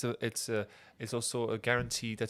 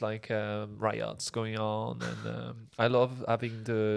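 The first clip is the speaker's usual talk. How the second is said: slow, drawling mock Parisian; Parisian accent; slower than usual. Shown as a demonstration. Mock Parisian chatting about wine and riots, slow Parisian accent. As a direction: languid Parisian accent, dragging the words. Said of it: "that's the Parisian people".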